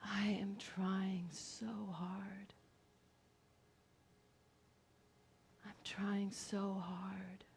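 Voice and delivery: soft, slow, drawn-out near-whisper; weary hushed plea. Acted. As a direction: confess it quietly, stretching each word. Confession in a performed poem.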